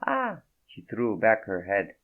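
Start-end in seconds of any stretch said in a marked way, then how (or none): 0.0-0.4 high, breathy-free French woman's voice, much higher; a startled French woman, voiced high; French accent. Naked woman startled by Fredo, in a high French accent.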